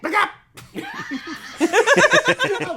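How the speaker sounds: gushing through a laugh; loud, laughing delight. Real, not acted. Gushing over actress while laughing.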